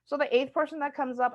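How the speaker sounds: normal talking voice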